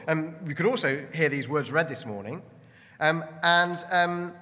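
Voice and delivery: normal talking voice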